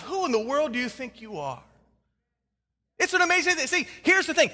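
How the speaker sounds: ordinary talk, nothing marked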